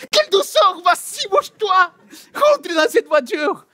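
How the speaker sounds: put-on high, strained yelling at the kids; exasperated high shout. Father yelling at his kids to get in the car, high and furious. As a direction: scream at them to get in the car. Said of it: "tu commences à t'énerver".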